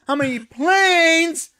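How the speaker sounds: slow, loud, high disbelieving yell; exasperated shout. Real, not acted.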